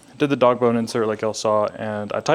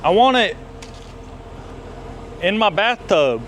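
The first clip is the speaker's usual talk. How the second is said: excited; 8 semitones higher than usual. Pretend. Excited reply in bit.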